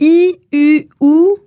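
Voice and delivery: I, U, OU said with the mouth nearly shut; tight, closed vowels I, U, OU. Shown as a demonstration. Teacher demonstrating the closed vowels to listeners. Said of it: "vous pouvez l'essayer à la maison".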